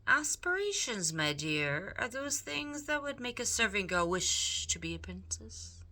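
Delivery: Roscoe the rat. Acted. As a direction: speak up sweetly like a charming villain. Roscoe explains aspirations.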